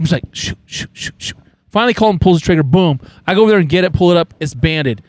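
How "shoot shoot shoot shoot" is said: soft unvoiced whisper; whispered urging. Hunter urging his nephew to shoot, whispered. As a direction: hiss urgently so the ducks don't hear.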